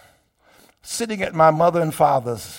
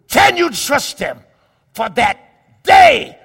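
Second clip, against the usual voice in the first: loud, rising preacher cadence that climbs high; fiery preaching; 5 semitones higher, 9 dB louder than usual. Acted. Preacher proclaiming to his congregation at full force.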